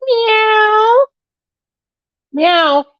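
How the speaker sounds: high mock meow, then coaxing; cat meow. Owner coaxes Luna to meow for a viewer, high mock meows. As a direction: squeak out meows to coax the cat.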